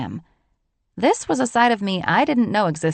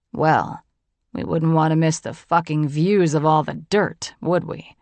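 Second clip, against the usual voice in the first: sarcastic man; 3 semitones lower than usual. Acted. John mocking sarcastically.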